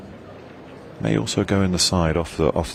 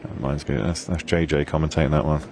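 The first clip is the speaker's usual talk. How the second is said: normal talking voice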